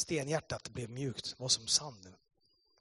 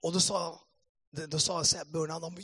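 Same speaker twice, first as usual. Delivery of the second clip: ordinary talk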